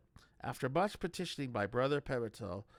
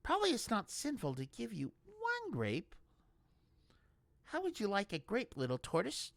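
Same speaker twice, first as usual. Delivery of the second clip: high, tender coaxing; gentle to animal; 10 semitones higher than usual. A narrator's reading. Brutha coaxes the tortoise, high and gentle. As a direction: talk to a small creature kindly.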